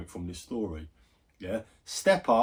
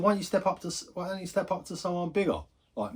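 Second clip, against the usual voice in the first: retold challenge, pitched up high; squaring up to a bully, voice raised high; heated challenge; 7 semitones higher, faster than usual. Storyteller squaring up to the mocking lad, raised challenge.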